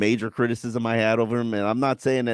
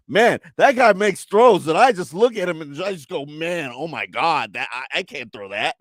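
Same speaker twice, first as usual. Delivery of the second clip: higher, louder, then slowed awestruck gush; imitating Tua; 7 semitones higher, 5 dB louder than usual. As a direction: gush like a wide-eyed fan of a rival. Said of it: "No, he said".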